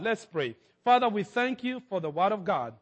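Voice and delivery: ordinary talk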